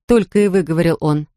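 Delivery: plain reading voice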